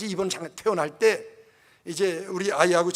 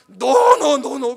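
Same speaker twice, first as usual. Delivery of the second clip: put-on loud, high desperate child's no; child's screaming cry; 5 semitones higher, 13 dB louder, much slower than usual. Pastor voicing his little daughter crying no as she is wheeled away, loud and high. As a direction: scream it like a child torn from her parents.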